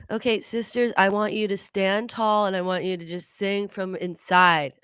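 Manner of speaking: usual speaking voice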